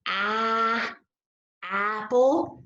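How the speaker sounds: AH held long, then the same vowel opening APPLE; short A demo. Shown as a demonstration. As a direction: stretch AH as if biting into an apple. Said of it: "when you go to bite into an apple".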